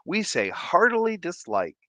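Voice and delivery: high, drawn-out chiding counselor; cartoon counselor gently scolding; show counselor. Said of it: "he was, like, the counselor".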